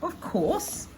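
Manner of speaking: high-pitched gentleman's flourish; a high, swooping gent's reply; jovial gent. A narrator's reading. Gent inviting Nessie to dinner, jovial. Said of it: "offers that gent with a laugh".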